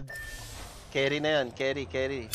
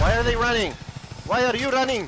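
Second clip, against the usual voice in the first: shouting orders over battle; 7 semitones higher, 4 dB louder, faster than usual. Real, not acted. Chasing fleeing enemies.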